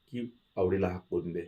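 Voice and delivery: soft, subdued quote of the demand; understated tearfulness. A narrator's reading. Avdi repeating the village's demand to Dhanaji, soft and tearful. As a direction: repeat it quietly, hurt and near tears. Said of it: "असं म्हणून ती रडू लागली".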